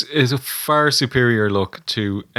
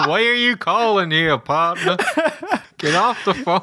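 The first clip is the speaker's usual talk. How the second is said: mock Texan wrestler; 10 semitones higher than usual. Friend imitating the hotline wrestler, high laughing mock voice. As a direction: lay on a jokey cowboy voice.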